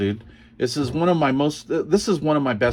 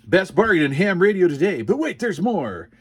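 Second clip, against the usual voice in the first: mock TV pitchman, a touch higher and brighter in energy; infomercial pitchman; 5 semitones higher than usual. To viewers about QSL cards, mock infomercial pitchman. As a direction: sell the QSL cards like a late-night ad.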